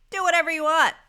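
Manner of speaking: high, loud, carefree imitation of parents; breezy permission. Parents waving off their youngest child, breezy permission. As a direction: toss it out like an indulgent parent.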